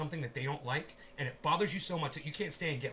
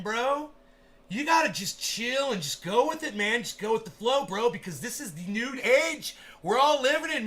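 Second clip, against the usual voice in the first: a lightly raised, laid-back bro talking himself down; mock chill bro voice; 6 semitones higher, 6 dB louder than usual. Streamer playing his own inner voice telling himself to chill, mock mellow bro. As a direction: coax yourself to relax, dude. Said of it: "I'm like".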